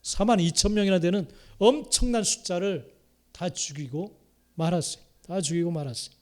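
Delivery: usual speaking voice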